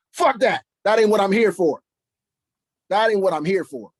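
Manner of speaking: loud, high furious outburst; angry shout. Real, not acted. Rejects soft delivery, loud.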